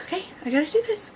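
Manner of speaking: quick, high, soft self-talk; hushed, rushed resolve; acted freaking out. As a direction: psych yourself up in mock panic, hurriedly. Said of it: "she starts freaking out".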